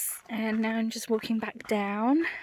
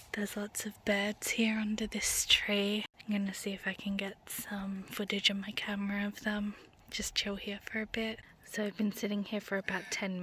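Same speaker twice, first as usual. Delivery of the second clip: whispering; 7 dB quieter than usual. Real, not acted. Filming birds under a tree.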